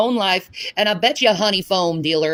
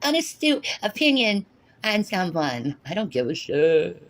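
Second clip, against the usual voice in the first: higher snooty mock voice; mocking impression; 5 semitones higher than usual. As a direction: parody a smug churchgoer. Mocking Shanny's chat persona.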